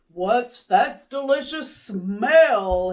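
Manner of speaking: a hint of a curious father sniffing the air; father's voice. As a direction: savour the words like a hungry dad.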